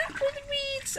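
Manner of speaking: very high, light duckbill cheer; hooting. A narrator's reading. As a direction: hoot it high without shouting. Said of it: "and they hooted".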